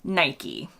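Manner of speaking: "Nike." said NY-kee, two syllables, low and soft; American "Nike." with a long ee at the end. Shown as a demonstration. Host gives the American way to say the brand, low and soft. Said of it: "In the U S, we say almost without fail or exception".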